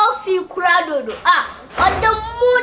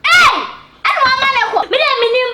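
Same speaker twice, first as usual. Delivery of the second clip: much higher, louder outburst; angry shout; 6 semitones higher, 3 dB louder, faster than usual. Acted. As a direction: cut in with a furious yell. Heated argument outburst.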